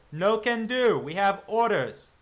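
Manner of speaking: loud, high, slow refusal; firm guard. Acted. Guard refuses the werewolf hunter, loud and firm. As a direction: plant your feet and refuse. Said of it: "the guard goes".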